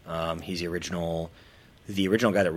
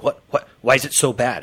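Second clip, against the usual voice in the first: disbelief; 8 semitones higher, 12 dB louder than usual. Retold or imitated. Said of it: "It's almost like they're in disbelief".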